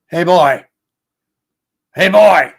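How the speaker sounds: put-on grandfather calling the boy, loud and high. Grandfather calling his grandson across the yard, loud call. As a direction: holler across the backyard. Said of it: "And he would go".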